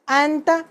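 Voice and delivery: अंता, the n half swallowed into the t; nasal n in anta. Shown as a demonstration. Teacher to class: अंता with the n hidden, as in पंखा. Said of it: "जैसे पंखा में नून पे थोड़ा अख्फा".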